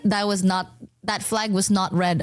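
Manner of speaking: normal talking voice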